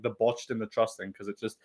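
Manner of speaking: ordinary talk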